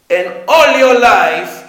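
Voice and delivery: loud, drawn-out reproach; Hashem. Acted. Hashem reproaching a soul at trial, loud accusation. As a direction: hurl the accusation slowly and loudly.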